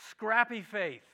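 normal talking voice

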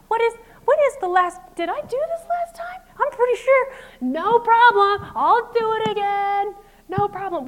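high, loud, sing-song sarcasm; sarcastic martyr. Retold or imitated. The annoyed mom at the overflowing trash can, mocking with sarcasm. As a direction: overdo the fake cheerful "no problem". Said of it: "my negative thoughts start to spike".

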